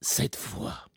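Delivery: low, slow menacing whisper; serial killer voice. Shown as a demonstration. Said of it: "ma voix de tueur en série".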